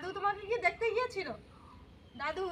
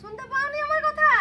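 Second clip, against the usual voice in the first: loud, high call to grandma; 6 semitones higher, 9 dB louder than usual. Real, not acted. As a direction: shout so she can hear. Calling out to deaf grandma.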